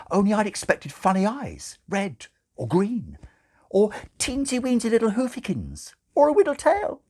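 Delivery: put-on high, cooing nun gushing over a baby; gushing baby talk. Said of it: "said Sister Mary".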